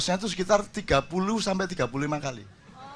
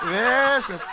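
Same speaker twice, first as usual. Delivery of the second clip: excited exclamation; 5 semitones higher, 5 dB louder than usual. Real, not acted. Exclaiming over his naughty past.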